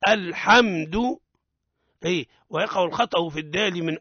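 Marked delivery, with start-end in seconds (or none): 0.1-1.2 الحمد loud, m closed and held without a bounce; still held meem. Shown as a demonstration. Teacher correcting the bounced meem, firm held m. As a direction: raise the voice and hold the m in الحمد. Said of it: "لها ضوابط".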